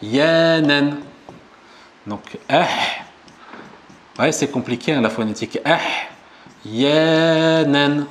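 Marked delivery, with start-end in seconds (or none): 0.0-1.0 "Ahyanan" held long and even, each syllable clear; model pronunciation. Shown as a demonstration. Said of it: "je prononce avec vous". 6.6-8.1 "Yanan" drawn out evenly; model pronunciation. Shown as a demonstration. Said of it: "je prononce avec vous".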